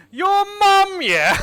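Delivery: high, very loud 'your mum' jeer; defiant playground yell; shouted comeback. Pretend. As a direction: blurt the catchphrase at full blast, stretching 'mum'. Answers pretend review with catchphrase.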